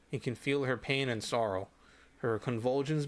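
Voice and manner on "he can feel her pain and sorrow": ordinary delivery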